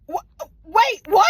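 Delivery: loud, high yelp of disbelief; mock outraged shout; shocked shout. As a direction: blurt it out as everything collapses. Ring thrown into the pit.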